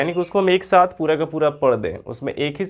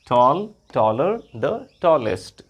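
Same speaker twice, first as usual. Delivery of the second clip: tall, taller, the tallest spaced out very slowly; slow recitation; much slower than usual. Shown as a demonstration. Teacher reciting the three degrees to the class, very slowly. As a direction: lay out each form one by one, slowly. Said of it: "इसका comparative degree होता है taller".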